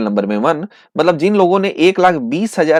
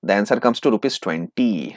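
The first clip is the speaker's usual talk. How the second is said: very slow and softer; slow answer reveal; 6 semitones lower, 6 dB quieter, much slower than usual. Acted. Teacher gives students the balance answer, slowly and softly. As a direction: let the answer land slowly and quietly.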